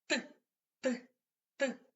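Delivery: clipped, isolated "Т", three separate taps; hammer sound "Т". Shown as a demonstration. Teacher showing children how a hammer knocks, separate "Т" taps. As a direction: tap out "Т" like a hammer, evenly spaced. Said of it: "Молоток стукає".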